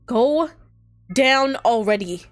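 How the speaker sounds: frantic high shout at the clogged drain. Acted. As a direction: scream it at the sink, voice cracking. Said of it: "then shouting".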